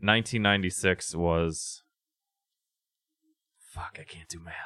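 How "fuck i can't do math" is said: hushed self-directed whisper; whispered aside. Real, not acted. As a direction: whisper it to yourself, annoyed. Muttered aside mid-count.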